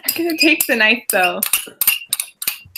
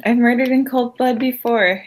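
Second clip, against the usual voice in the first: ordinary talk, nothing marked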